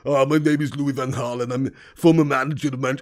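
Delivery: put-on Dutch-accented van Gaal, low, a touch like Schwarzenegger; heavy Dutch manager voice; Louis van Gaal impression. Van Gaal doing his own intro for chat, Dutch accent. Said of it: "Van Gaal is easy to do".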